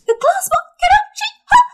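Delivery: high, startled outburst; shocked squeal. Acted. Captive shrieks at the glass ball question. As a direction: squeak it out in disbelief.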